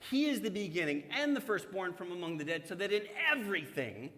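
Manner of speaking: preacher reading Colossians to congregation; scripture reading. Acted. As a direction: proclaim the passage to the church. Reads Colossians aloud.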